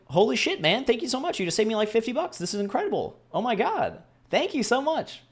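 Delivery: high, gushing, overjoyed thanks. Retold or imitated. Thanking the stranger for finding the bars, gushing high. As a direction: squeal your gratitude like he saved your life.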